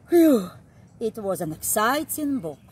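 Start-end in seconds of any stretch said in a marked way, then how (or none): 0.1-0.5 big, loud sigh of relief; loud relieved phew. Acted. Relieved that the bunny escaped, a loud phew. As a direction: blow out relief after the scare.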